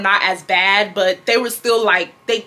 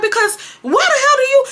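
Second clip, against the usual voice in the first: high-pitched outraged mother imitation; hammed-up scolding; angry mom; 3 dB louder than usual. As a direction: demand it as a fed-up parent. Retold mother scolding in quarrel.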